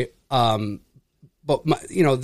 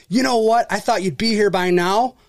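imitating sudden irritation, pitched up; mock-angry, higher-pitched complaint; retold pissed-off customer; 6 semitones higher than usual. Customer whose switch just flipped, retold in pissed-off imitation. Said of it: "he was fucking pissed".

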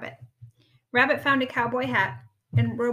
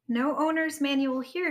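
higher, raised call; shouted; 4 semitones higher, 3 dB louder than usual. A narrator's reading. Rabbit shaking the coat rack, mildly shouting. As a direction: call out from the search. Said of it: "he shouted".

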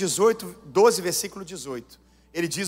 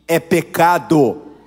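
"é pecado" slow, loud, low, each syllable weighed; stress on pecado; 4 semitones lower, 8 dB louder, much slower than usual. Real, not acted. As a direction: land "pecado" heavy and slow. Slow emphatic close. ("pecado" lento, forte e grave)

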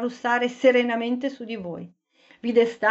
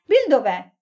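calling out; 5 dB louder than usual. A narrator's reading. Looking for lost friend.

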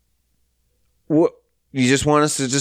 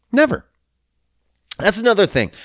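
usual speaking voice

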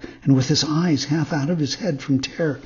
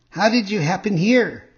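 higher-pitched fox character greeting; 6 semitones higher, 4 dB louder than usual. A narrator's reading. Fox greets the marionette, higher-pitched character voice. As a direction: voice a fox hailing someone, pitched up.

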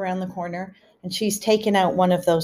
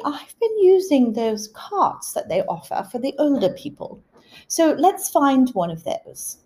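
higher-pitched imitation of an English mother; English accent; 7 semitones higher than usual. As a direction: play English Mum, pitched up, drawing out the last words. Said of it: "switch into an English accent, I'm imitating my mother".

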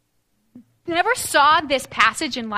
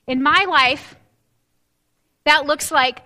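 usual speaking voice

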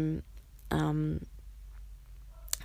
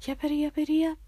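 yapping dog voice; 10 semitones higher, much faster than usual. Pretend. Mocking yapping dogs.

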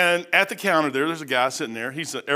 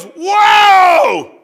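a huge high whoa, much louder; imitated loud exclamation; 13 dB louder than usual. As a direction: belt out a startled veteran's whoa. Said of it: "this is the guy".

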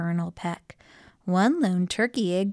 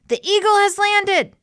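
loud, very high shout; 14 semitones higher, 7 dB louder than usual. A narrator's reading. Detective shouts the secret code, high urgent shout. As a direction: holler a signal for backup. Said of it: "I shouted the secret code".

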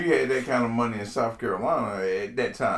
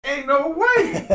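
laughing disbelief; 3 dB louder, slower than usual. Real, not acted. Laughing at the room count.

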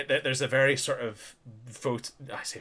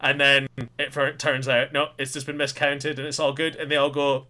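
usual speaking voice

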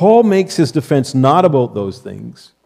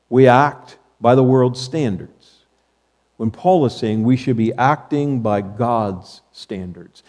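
usual speaking voice

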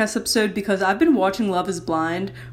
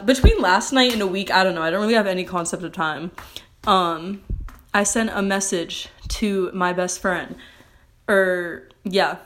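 normal talking voice

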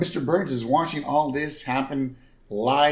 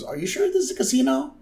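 1 mock puzzled Robert; 11 semitones higher, faster than usual. Robert's puzzled quote, high and fast.

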